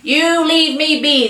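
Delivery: high, slow, protesting cry; old man's cry. A narrator's reading. Old man cries out at the taunting boys, protesting. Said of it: "the old man cries".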